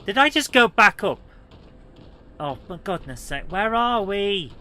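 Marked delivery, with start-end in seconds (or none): 0.1-1.2 high, loud exasperated question; loud disbelief. Real, not acted. Gamer lost in the level, loud disbelief. 3.5-4.5 slow, high, loud wail of confusion; drawn-out exasperation. Real, not acted. Gamer lost in the level, drawn-out exasperated cry.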